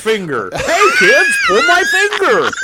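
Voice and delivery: booming tipsy uncle. Pretend. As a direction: bellow it like a silly uncle at a party. Goofy uncle joke to kids.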